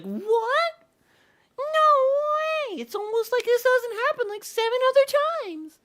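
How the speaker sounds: very high, loud squealing mock astonishment; Paimon. Streamer mocking the companion's surprise at yet another doom, high loud squeal. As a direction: squeak it out far above your voice, loud and sarcastic. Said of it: "And Paimon's like".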